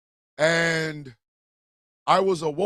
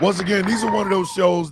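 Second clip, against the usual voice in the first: shouting; 5 semitones higher, 3 dB louder than usual. Real, not acted. Shouted emphasis.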